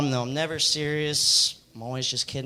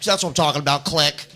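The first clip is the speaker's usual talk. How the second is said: louder, retold irritated outburst; mock exasperation, pushed louder; pissed-off friend; 6 dB louder than usual. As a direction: imitate a friend losing patience. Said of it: "then he got pissed".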